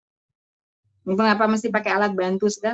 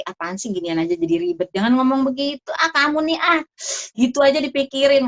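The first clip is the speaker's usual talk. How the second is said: mock male scolding, building higher; dismissive husband; 5 semitones higher, 3 dB louder than usual. Husband brushing off his upset wife, mock exasperated. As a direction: act out an impatient man brushing off her worries. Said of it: "Kalau laki-laki".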